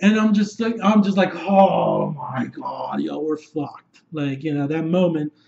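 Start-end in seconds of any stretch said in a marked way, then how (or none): 1.3-4.0 low, drawn-out groan of defeat; hopeless dread. Retold or imitated. Fan reliving the moment all hope is lost, slow dread. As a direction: stretch it out slowly, sinking in despair.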